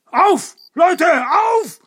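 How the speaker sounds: high, loud battle cry; rally the gang loudly; shouted charge order. Acted. Boss rallies gang to attack.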